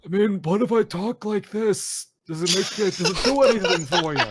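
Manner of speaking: put-on high-pitched, hammy New York accent; bad New York accent. Teasing a friend with a voice, bad New York accent. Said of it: "bad at a New York accent".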